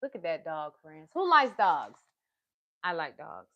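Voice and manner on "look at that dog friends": ordinary delivery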